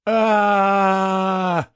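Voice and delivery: long, high, loud "aaah" scream; furious scream. A narrator's reading. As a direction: scream out all your rage in one held cry. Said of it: "закричал Андрес, вкладывая всю свою ярость". (яростный протяжный крик)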